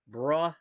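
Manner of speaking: bruh said with the "bre" vowel of umbrella; a short rising bruh. Shown as a demonstration. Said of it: "like umbrella".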